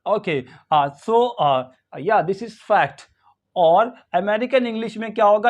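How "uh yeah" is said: ordinary talk, nothing marked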